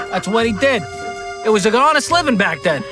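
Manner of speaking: loud, high New York mob guy; mobster accent. Acted. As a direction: play an old-school mobster defending Pop Pop. Pop Pop mafia voice.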